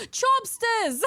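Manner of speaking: high gasped delight; excited gasp. Real, not acted. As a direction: gasp the name, thrilled, high. Gasping at the name.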